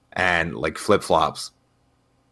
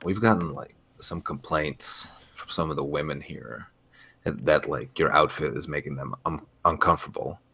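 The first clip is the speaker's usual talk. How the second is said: soft, halting staff complaint; hushed, hesitant official; gym staffer; 5 semitones lower, 8 dB quieter than usual. Retold or imitated. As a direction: awkwardly deliver bad news in a low voice. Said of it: "and they were like".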